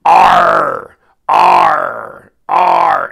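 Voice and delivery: loud, rolling pirate growl on each R; three beastly growls. Retold or imitated. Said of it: "three utterances past that word".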